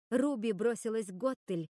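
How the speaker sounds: ordinary delivery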